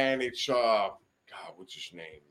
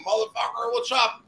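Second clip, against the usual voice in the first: high, rowdy yelled greeting; mock rowdy shout; 10 semitones higher than usual. Drunk in first class, calling back to Gully: rowdy high shout. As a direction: holler it like drunk buddies greeting a friend. Yelling greeting through plane curtain.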